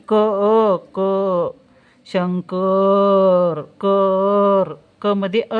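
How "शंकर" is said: शंकर held very long, syllable by syllable; teacher stretching शंकर; slow sounding out. Shown as a demonstration. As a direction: drag out शंकर so pupils hear the n. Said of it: "नचा उच्चार होतो".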